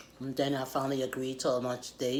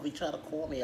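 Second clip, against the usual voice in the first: ordinary delivery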